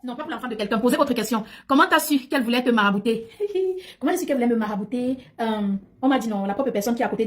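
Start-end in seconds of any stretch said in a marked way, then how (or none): 3.3-3.8 short laugh. Real, not acted. Laughs at viewer question.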